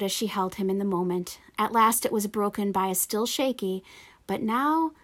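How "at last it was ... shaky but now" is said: usual speaking voice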